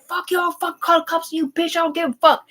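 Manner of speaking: high-pitched mock ranting; crazy sister impression. Redhead sister talking shit on the sidewalk, imitated ranting. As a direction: imitate her cursing in a high voice. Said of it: "the one that was kinda crazy, talking shit".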